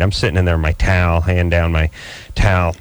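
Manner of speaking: ordinary talk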